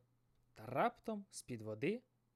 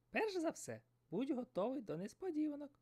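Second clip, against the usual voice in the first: seagull voice; 13 semitones higher than usual. Acted. Seagull advises.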